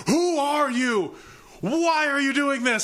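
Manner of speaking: high, slow, amazed questions; high incredulous voice. Retold or imitated. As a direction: act stunned and impressed. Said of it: "They were just like".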